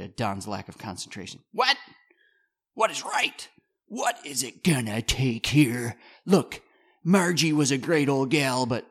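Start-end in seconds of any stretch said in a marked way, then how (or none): 1.5-8.9 frustrated boss. Acted. Rich frustrated at Don.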